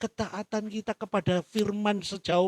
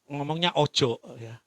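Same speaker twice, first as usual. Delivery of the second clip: ordinary talk, nothing marked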